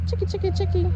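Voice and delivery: high loud repeated call; calling chickens. Real, not acted. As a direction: sing it out to the birds.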